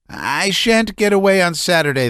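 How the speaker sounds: high, strained, nervous voice; a man near hysterics pouring it out; agitated Laevsky. A narrator's reading. Laevsky's thought.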